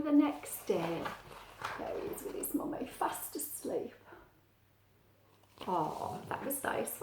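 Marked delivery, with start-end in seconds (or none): 1.8-7.0 soft bedtime whisper. Real, not acted. Reader whispering to the child over sleeping Pete. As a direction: hush so as not to wake him.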